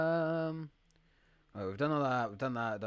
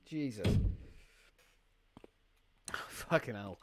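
ordinary talk, nothing marked

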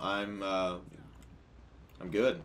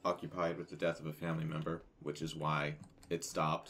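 low, soft and subdued, holding it together; sharing sad family news, quiet and heavy; subdued and sombre; 5 semitones lower, 9 dB quieter than usual. Real, not acted. Explaining grandmother's death.

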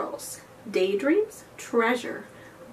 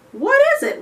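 put-on much higher, louder wondering girl; curious girl; 8 dB louder, much faster than usual. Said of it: "wondered Belle".

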